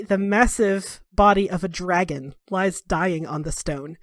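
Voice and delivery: ordinary talk, nothing marked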